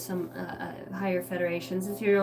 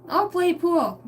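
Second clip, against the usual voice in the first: sudden eager yes, much higher; suddenly keen, pitched up high; 9 semitones higher, 5 dB louder, slower than usual. Acted. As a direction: jump at the chance to play pool. Switching answer, voiced eagerly.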